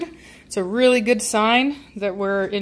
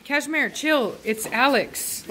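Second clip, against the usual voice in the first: ordinary talk, nothing marked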